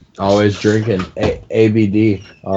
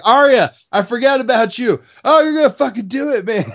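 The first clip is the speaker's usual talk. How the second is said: high, fast thrilled cry; suddenly hyped for Arya; thrilled realization; 13 semitones higher than usual. Retold or imitated. Fan seeing Arya strike, high hyped cry. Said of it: "all of a sudden you're like".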